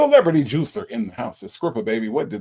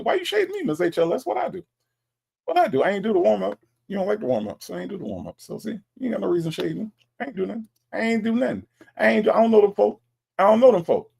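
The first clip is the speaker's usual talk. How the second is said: mock defensive; 7 semitones higher, much faster than usual. Playful protest to a chat viewer.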